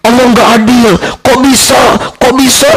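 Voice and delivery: high, lightly acted wailing complaint; mock lament. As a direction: imitate a grieving person protesting. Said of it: "saking sedihnya dia meratap". (meratap pura-pura dengan suara tinggi)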